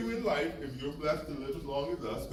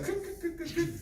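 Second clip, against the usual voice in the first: clicking noise; 14 semitones higher than usual. Retold or imitated. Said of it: "my back is like".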